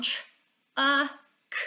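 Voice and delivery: tr- uh k as separate phonics sounds; sounding out words. Shown as a demonstration. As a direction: split truck into tr-, uh, k. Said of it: "write the word down".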